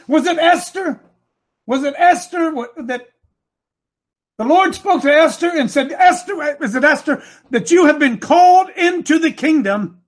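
high, urgent sermon delivery; impassioned preaching. Acted. Preacher retells the Lord speaking to Esther, impassioned.

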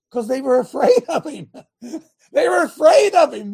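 laughing loudly through the words. Real, not acted. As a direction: let the laugh burst through as you say it. Preacher laughs at his own point.

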